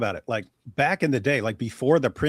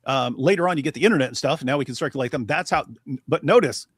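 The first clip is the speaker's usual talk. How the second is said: ordinary talk, nothing marked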